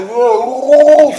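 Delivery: pushed to drink, protesting loudly and slowly. Acted. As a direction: blurt it out, drawn out and loud. Pressed to drink up.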